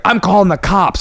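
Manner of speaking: threatening driver. Retold or imitated.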